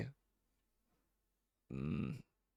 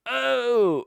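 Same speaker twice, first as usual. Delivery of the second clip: high, startled acted yelp; panicked; 13 semitones higher, 5 dB louder than usual. Little creature tucking himself away, high startled yelp. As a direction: squeak it out in mock alarm. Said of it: "he kind of panics".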